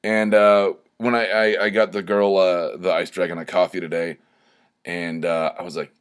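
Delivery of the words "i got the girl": ordinary talk, nothing marked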